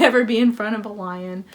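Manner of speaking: ordinary talk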